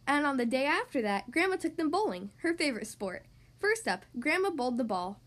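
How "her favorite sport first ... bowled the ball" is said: plain reading voice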